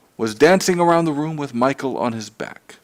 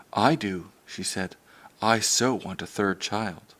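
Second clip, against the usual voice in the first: tender; 3 semitones lower, 7 dB quieter than usual. Acted. Mother comforts little Michael.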